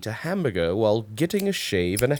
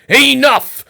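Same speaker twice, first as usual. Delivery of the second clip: loud, high furious roar; roaring king; 9 semitones higher, 8 dB louder than usual. A narrator's reading. King roaring at the rude wizard, furious. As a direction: bellow him out of the castle. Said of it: "King Burger jumped to his feet and roared".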